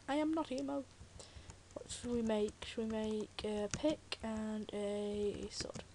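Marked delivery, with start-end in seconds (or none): 2.7-5.8 slow whispered question. Real, not acted. Gamer planning tools, whispering slowly.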